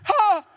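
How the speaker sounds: loud, high yelp as if bitten; hammed-up sudden scream; startled yell. Acts Paul's reaction to the bite.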